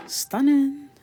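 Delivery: high, loud drawn-out stunning; excited praise. Real, not acted. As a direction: gush over the look. Admiring her makeup.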